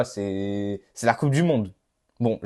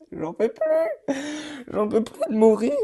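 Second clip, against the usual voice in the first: laughing despair; 13 semitones higher, slower than usual. Real, not acted. Dying over and over in game.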